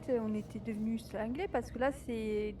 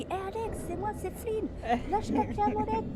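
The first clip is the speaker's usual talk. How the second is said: higher mock-pleading voice; mild imitation of a raised, anxious appeal; Flynn, replayed; 8 semitones higher than usual. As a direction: lightly re-enact your own in-character plea. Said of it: "moi, je fais comme si qu'elle est Alex".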